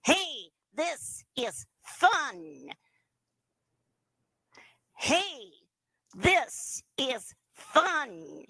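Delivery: slow nasal dummy voice, lips still. Shown as a demonstration. Teacher leading students in the nasal ventriloquist voice. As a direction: draw it out through the nose. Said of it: "get that nasal voice again".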